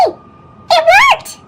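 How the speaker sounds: high, bright surprise; delighted. A narrator's reading. To the listening child, a high delighted "it worked". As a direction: pop it up like good news. Kindness succeeds again.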